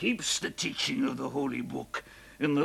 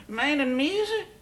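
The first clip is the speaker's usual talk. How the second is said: drunken mock echo; 8 semitones higher, 3 dB louder than usual. Drunk husband mocking wife's words.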